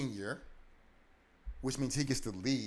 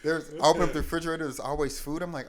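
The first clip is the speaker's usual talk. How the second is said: higher, louder and fast, bursting with wonder; excited; 6 semitones higher, 10 dB louder, faster than usual. Retold or imitated. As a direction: rush it out, thrilled. Retelling excitement at full fridge.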